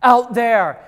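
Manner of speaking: high-pitched mock alarm at a sight; alarmed disciples. Disciples spotting Jesus on the sea, alarmed. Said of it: "they think he's a ghost".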